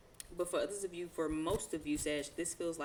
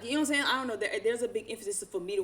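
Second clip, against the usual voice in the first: much higher and louder burst; emphatic; 5 semitones higher, 6 dB louder, faster than usual. Real, not acted. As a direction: push it out with fired-up insistence. Emphatic aside about Sagittarius.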